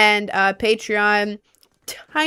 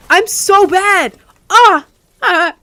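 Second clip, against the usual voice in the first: frustrated wail; 8 semitones higher, 7 dB louder, slower than usual. Real, not acted. Dying in game, frustrated.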